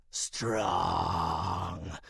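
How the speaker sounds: low rasping werewolf growl; low, menacing werewolf rasp; rasping creature. Acted. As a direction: rasp it out like a beast. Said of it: "rasped the creature".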